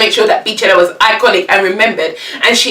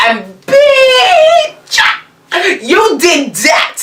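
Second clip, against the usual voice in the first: hype shout; 10 semitones higher, much slower than usual. Real, not acted. Fan shouting praise at [name], screamed and thrilled. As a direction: yell it out like a fan losing it.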